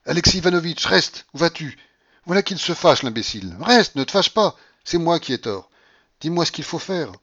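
high, loud exasperated appeal; urgent calling back. A narrator's reading. Grandmother stopping her offended companion, urgent and exasperated. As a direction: call them back urgently.

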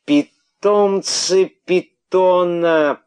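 very high, loud, slow piercing cry; shrieking serpent king. Acted. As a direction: pierce through the hiss. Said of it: "пронзительным голосом одолевая природное шипение".

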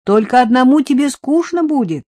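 cheerful shout. A narrator's reading. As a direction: shout it merrily with a lifted voice. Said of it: "весело крикнула она".

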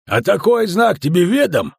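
loud, high, gruff threat; gruff challenge. A narrator's reading. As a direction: confront the officer menacingly. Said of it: "буркнул он при этом".

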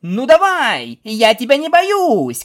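high, loud, drawn-out dare; defiant shout. Acted. Pegasus cocking a pretend gun at the stallion, defiant shout. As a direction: shout a bold challenge. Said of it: "крикнула Скуталу".

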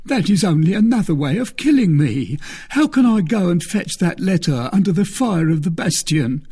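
high, alarmed protest; crying out. A narrator's reading. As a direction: object desperately to a deadly order. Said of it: "cried the bandit".

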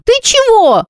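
startled shriek of a question; shrill squeal. A narrator's reading. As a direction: squeal it out in fright. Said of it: "взвизгнула девица".

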